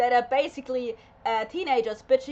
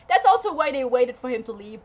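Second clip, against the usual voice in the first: ordinary talk, nothing marked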